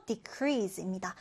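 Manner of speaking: decrease said de-CREASE, slow, the weight on CREASE; stress on decrease. Shown as a demonstration. Teacher modelling the verb stress of decrease. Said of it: "반대말은요".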